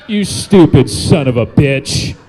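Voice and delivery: ordinary talk, nothing marked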